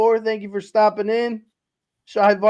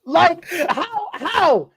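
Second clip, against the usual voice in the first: higher, laughing through the words; 8 semitones higher, 3 dB louder than usual. Real, not acted. Host laughing while joking that it was five minutes. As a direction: laugh it out in disbelief.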